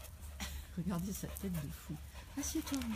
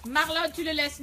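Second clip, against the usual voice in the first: loud, higher firm order to the dog; firm command; 6 semitones higher, 10 dB louder than usual. Real, not acted. Owner ordering Marlon to leave the other dog, loud and firm. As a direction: make him drop it at once.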